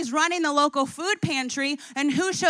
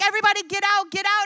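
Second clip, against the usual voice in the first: shouted order; 7 semitones higher, 5 dB louder, faster than usual. Acted. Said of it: "And he's like".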